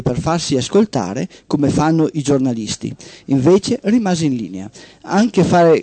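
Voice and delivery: steady radio storytelling voice; host reading a short story on air; story narration. Acted. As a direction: read it like a tale to the listeners. Host reads a story aloud.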